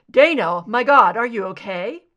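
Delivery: loud, frightened rush of worry; anxious lover calling out loud; alarmed concern. Acted. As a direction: cry out in relief and alarm. Benton rushes to hurt Scully.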